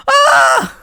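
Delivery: loud, very high scream; terrified scream. Acted. Leo panics at his brother's call, screaming. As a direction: scream at the top of your range. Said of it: "he screamed as he fumbled to hang up the phone".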